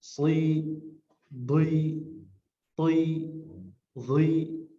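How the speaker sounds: Si, dui, tui, zui kept thick even with the i vowel; heavy syllables spelled out in a drill; heavy kasroh. Shown as a demonstration.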